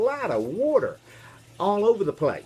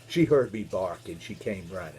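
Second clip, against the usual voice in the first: usual speaking voice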